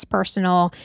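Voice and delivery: usual speaking voice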